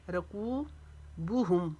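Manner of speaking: रकूबुहुम very slow, बू kept short; slow recited रकूबुहुम; tajweed recitation. Shown as a demonstration. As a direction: chant रकूबुहुम, stretch कू only. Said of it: "बू को नहीं खींचेंगे".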